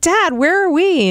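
put-on high, slow, puzzled child; a little kid asking dad; confused kid voice. Kid asking father about photos.

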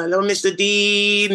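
put-on high sing-song Filipino accent greeting; sing-songy Filipino accent. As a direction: lilt it like a friendly Filipino cruise steward. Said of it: "it's very sing-songy".

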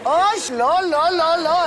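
loud, high no no no; horrified protest. Acted. Woman refusing the ugly bracelet, loud and horrified. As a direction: cry out in dismay.

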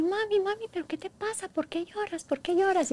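high, soft child's voice, imitating her daughter; sweet little girl voice. Mother imitating her sick daughter asking why she cries, high sweet child voice. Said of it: "Y su vocecita dulce, tierna".

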